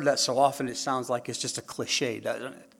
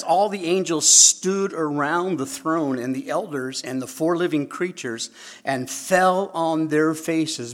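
loud, raised reading voice; booming scripture; 4 semitones higher, 7 dB louder than usual. Acted. Preacher to congregation, reading out loud and high. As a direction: proclaim the verse to the hall.

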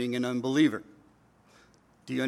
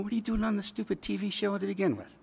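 high-pitched incredulous question; acted-out baffled inner voice; exasperated disbelief; 6 semitones higher, 4 dB quieter, faster than usual. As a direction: ask it in mock exasperation, pitched up. His exasperated reaction to the show.

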